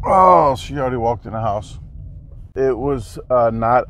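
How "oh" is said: loud, high sudden oh; surprised oh. Real, not acted. Spotting the lady already gone, surprised. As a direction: burst out in surprise.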